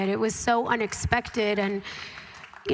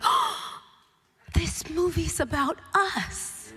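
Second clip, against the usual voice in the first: higher gasped discovery, drawn out slowly; awed realization; 7 semitones higher, slower than usual. Retold or imitated. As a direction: re-enact a breathtaking realization. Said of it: "being like".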